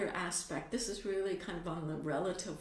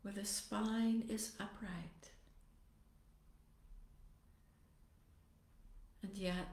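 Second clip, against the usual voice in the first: soft, unhurried meditation voice with long pauses; guided meditation; 8 dB quieter than usual. Acted. Teacher settling meditators into posture and compassionate motivation, guided meditation. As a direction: ease them gently into stillness, phrase by phrase.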